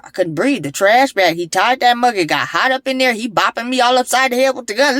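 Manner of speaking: usual speaking voice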